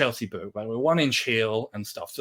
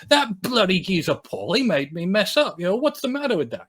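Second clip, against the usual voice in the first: high-pitched retold grumble; acting out an aggrieved drummer; indignant rant; 8 semitones higher than usual. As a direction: rant about the culprit, voice climbing. Drummer ranting in dressing room.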